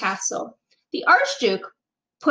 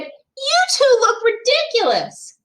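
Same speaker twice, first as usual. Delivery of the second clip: mocking jeer; 12 semitones higher, 3 dB louder than usual. A narrator's reading. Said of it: "Nate pointed and laughed and said".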